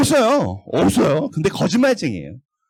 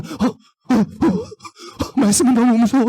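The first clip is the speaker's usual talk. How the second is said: mock-frightened Satan; 4 semitones higher, slower than usual. Teacher voicing Satan scared of Scripture, hammed-up fear. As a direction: play the devil cowering in fright.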